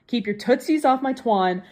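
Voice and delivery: ordinary delivery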